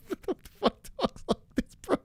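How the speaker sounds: squeaking high through laughter in disbelief; can't believe what he's reading, laughing. Real, not acted. Laughing at absurd chat message.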